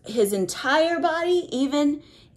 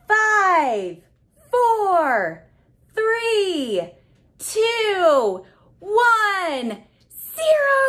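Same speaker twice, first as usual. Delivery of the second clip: climbing higher and louder to a cheer on zero; excited countdown; 9 semitones higher, 4 dB louder, slower than usual. Acted. Counting down with the child to blast off, excited. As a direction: count down like the rocket's about to launch.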